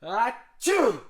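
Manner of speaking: a real loud sneeze. Real, not acted. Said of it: "tickle in my nose".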